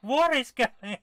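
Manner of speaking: loud, high, drawn-out disbelief; astonished exclamations. Real, not acted. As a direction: cry out at the absurdity, slowly. Amazed by absurd game.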